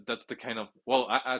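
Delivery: usual speaking voice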